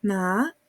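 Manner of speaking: MA rising from low; ma with a questioning upward glide; second tone. Shown as a demonstration. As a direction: start ma low and slide upward. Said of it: "el segundo ejemplo".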